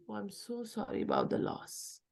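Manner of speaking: a soft sympathetic voice to a grieving relative; said in a nice way. Shown as a demonstration. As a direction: say it softly and kindly. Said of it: "said in a nice way".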